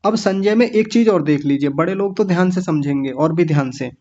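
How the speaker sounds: ordinary delivery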